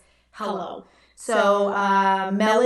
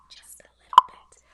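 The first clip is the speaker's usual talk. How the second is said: whisper. Acted. Whispered aside after diva remark.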